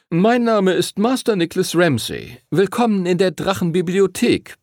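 high-pitched ceremonial greeting; solemn teacher's welcome. A narrator's reading. Teacher greets pupils at the dragon library, solemn. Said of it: "begrüßte der Lehrer sie feierlich".

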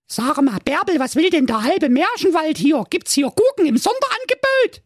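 put-on very high, squeaky girl voice; cheeky young girl.

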